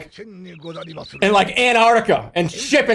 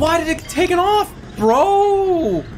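frustrated yell; 10 semitones higher than usual. Real, not acted.

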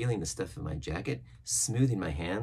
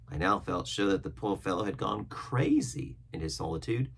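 plain reading voice

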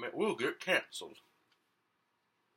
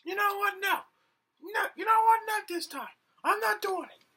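high-pitched, louder defiant character; 8 dB louder than usual. Acted. Game character refusing the host's challenge, high and defiant. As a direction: squeak out a stubborn refusal.

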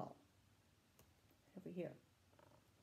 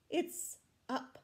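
plain reading voice

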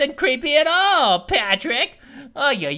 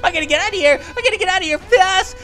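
high loud panicked yelling; being chased; 9 semitones higher, 4 dB louder than usual. Real, not acted. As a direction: yell while fleeing in panic. Fleeing the monster in game.

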